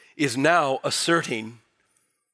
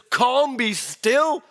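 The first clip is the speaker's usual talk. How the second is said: slow, higher commanding words; slow command to the storm, a touch higher; commanding calm; 7 semitones higher, 3 dB louder, slower than usual. Acted. Jesus voiced calming storm.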